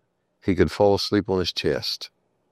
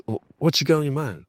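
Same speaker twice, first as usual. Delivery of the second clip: casual friendly ask; 7 semitones higher, faster than usual. Retold or imitated. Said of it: "and says".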